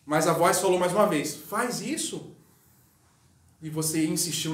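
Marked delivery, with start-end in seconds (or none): 1.3-2.3 slow, soft and tender command; gentle voice of God. Retold or imitated. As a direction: coax it out slowly like a calm divine voice. The inner voice of God speaking. (voz suave e lenta de Deus dando uma ordem)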